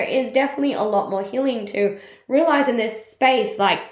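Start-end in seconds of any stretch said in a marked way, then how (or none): none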